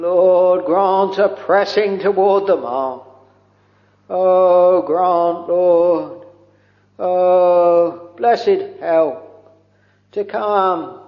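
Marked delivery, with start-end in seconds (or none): none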